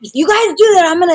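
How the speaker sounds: put-on mother threatening the kids, loud and high; stern mom warning. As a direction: scold the kids like the tough parent. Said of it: "I was the tougher".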